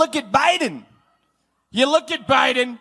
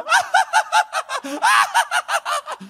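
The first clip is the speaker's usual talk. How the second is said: very high, rapid-fire mock cackle; Kamala Harris cackle. Imitating the vice president answering any question, hammed-up cackling. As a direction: burst into an exaggerated, piercing staccato cackle. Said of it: "then you got cackling Kamala".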